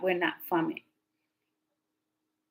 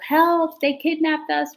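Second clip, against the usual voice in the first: high, louder mock call for help; retold yell for help; 8 semitones higher, 3 dB louder, slower than usual. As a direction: imitate yelling out for rescue. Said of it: "I'm yelling through the window".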